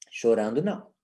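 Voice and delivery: low, even, unemotional refusal; calm fixed-phrase correction; unchanging intonation. Shown as a demonstration. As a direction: say it low without scolding. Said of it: "sem mudar a entonação de voz".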